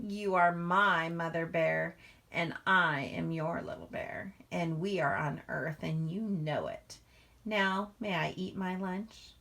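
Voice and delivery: lively, expressive read-aloud to a class; reading to children. A narrator's reading. Teacher reading a picture book to the class, lively read-aloud. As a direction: draw young listeners into the story.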